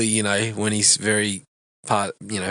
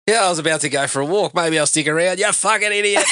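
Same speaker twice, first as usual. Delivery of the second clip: fast, higher-pitched retold movie rant; going off on a rant; 6 semitones higher, faster than usual. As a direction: rattle it off, climbing in pitch to the insult. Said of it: "he's just going off".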